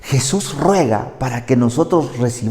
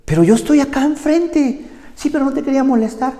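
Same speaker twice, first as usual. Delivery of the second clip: high, innocent mock wife voice; wife explaining she didn't want to bother him; imitating his wife; 14 semitones higher, faster than usual. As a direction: sweetly excuse herself. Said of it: "me dice".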